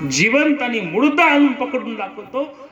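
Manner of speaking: high, laughing boast; boastful laugh. Acted. Siddi Johar boasts to capture.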